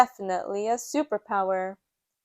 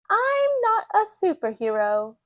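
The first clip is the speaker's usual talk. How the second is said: put-on high, louder child voice; high confident piglet; Peppa; 9 semitones higher, 4 dB louder than usual. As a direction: announce it proudly like a small girl. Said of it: "says Peppa".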